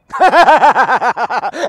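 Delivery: long rattling belly laugh; sudden loud cackling laugh; big laugh. Real, not acted. Laughs after saying I love you.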